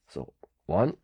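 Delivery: tight, constricted throat. Real, not acted. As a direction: voice squeezed tight by dust. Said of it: "makes me constrict my voice out of reflex".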